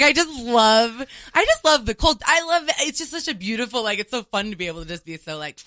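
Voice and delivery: gushing past self. Retold or imitated. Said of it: "I was just like".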